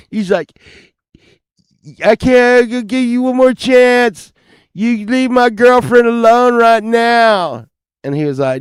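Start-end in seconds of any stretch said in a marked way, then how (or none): 2.0-7.7 high, loud strained yelling; angry ultimatum. Retold or imitated. Boyfriend warning the rival on the balcony, angry yell. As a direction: act out a jilted guy hollering upward.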